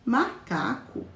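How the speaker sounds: macaco split slowly into ma-ca-co; teacher showing children the syllables of macaco; slow syllable demo. Shown as a demonstration. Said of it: "Olha aqui".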